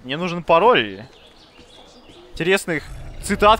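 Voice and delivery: ordinary talk, nothing marked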